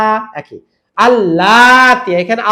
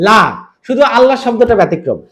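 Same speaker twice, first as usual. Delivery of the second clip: usual speaking voice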